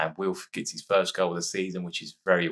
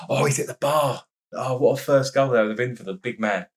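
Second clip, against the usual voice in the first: louder, higher burst of excitement; excited near miss; 6 semitones higher, 5 dB louder than usual. Real, not acted. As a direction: shout it out as the ball hits the bar. Near miss in live commentary.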